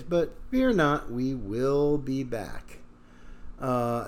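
ordinary delivery

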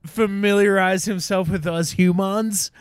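high, loud disbelief, slowing at the end; incredulous comic rant. Real, not acted. Podcaster mocks Zuckerberg to friends, loud incredulity. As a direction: ask it loudly, drawing out the punchline.

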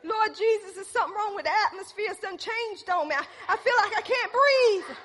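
high, loud, fast gasping plea; a comic cry for air in the truck; mock panicked prayer. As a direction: ham up being unable to breathe. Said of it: "I said".

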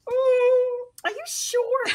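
high-pitched skeptical imitation; squeaky-high doubt tipping into laughter; mock doubting. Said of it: "it was the".

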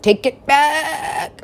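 high, drawn-out mock scream at a giant. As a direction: stretch it into a comic high wail. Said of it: "I'm just screaming at that giant".

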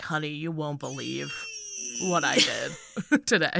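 a lightly voiced worker bragging to his wife; soft, low, half-laughing boast; hint of a giddy husband telling his wife; mock proud husband. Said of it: "they're like".

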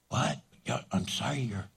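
hushed, calmed down; softened reply. Retold or imitated. Angry person now calmed, answering softly. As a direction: drop to their quiet level. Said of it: "speaking softly".

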